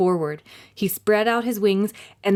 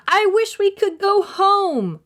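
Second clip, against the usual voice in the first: higher, louder, slow desperate wish; Jack shouting; 12 semitones higher, 4 dB louder than usual. A narrator's reading. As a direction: shout the wish with all hope. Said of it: "he shouted".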